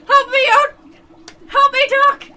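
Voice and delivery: high panicked screams for help; terrified screaming. Acted. Mike screaming as the creature bites him. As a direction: shriek like something has your arm. Mike being eaten alive.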